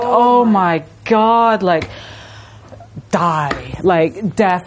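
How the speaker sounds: mock forlorn wail. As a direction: drag it out like a dying groan. Voicing the chime's despair.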